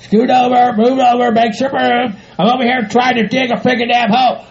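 ordinary talk, nothing marked

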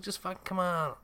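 put-on high nagging girl; bratty girl voice. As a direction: whine it like a pushy kid. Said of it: "she's like".

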